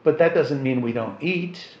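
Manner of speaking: normal talking voice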